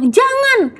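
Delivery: high, sharp mock warning cry; mother stopping a child, high and sudden; mom's sharp no. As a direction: yelp the word Jangan up high like a startled mom. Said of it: "kalau mama kamu masih bilang".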